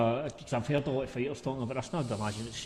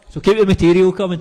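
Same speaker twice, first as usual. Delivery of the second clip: laughing; 6 semitones higher, 14 dB louder than usual. Real, not acted. Host jokes about Jenna's stories.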